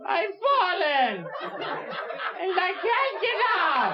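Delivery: put-on slow, high, whining old woman wail; obnoxious old lady. Comic imitating the fallen elderly woman from the commercial, slow high whine. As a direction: drag out every word like a helpless grandma. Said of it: "the most obnoxious voice in the world".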